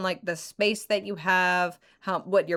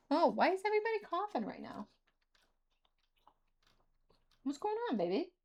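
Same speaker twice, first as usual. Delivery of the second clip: squeaky praising a dog; 9 semitones higher, 6 dB quieter, faster than usual. Real, not acted. Speaker turns to talk to dog.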